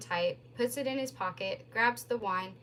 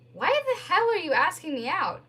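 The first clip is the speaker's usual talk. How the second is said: higher, louder indignant demand; angry outburst; 9 semitones higher, 8 dB louder than usual. Acted. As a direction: snap it in disbelief. Angry disbelief on phone.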